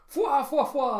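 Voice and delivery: loud, very high "fwa" laugh; put-on laugh in high bursts; cartoon laughter. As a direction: hoot the fwas out loudly. Crew's mocking laugh continues.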